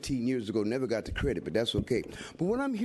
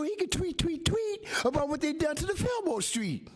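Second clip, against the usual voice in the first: worked-up, high-pitched public protest; emotional; 3 semitones higher than usual. Real, not acted. Activist warning officials he will go over their heads, emotional. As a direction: build to an impassioned pitch, voice climbing. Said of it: "forgive me for being emotional".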